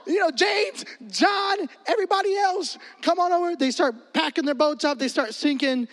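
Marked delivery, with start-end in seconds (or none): 0.1-3.6 excited shout. Retold or imitated. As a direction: yell for your friends to come see the catch. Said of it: "Simon's like".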